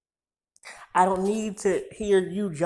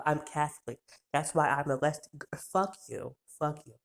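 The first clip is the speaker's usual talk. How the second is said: normal talking voice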